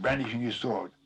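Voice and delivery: usual speaking voice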